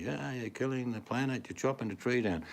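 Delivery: mock protester yelling. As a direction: put on a protester's cry without real volume. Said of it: "they're yelling and screaming".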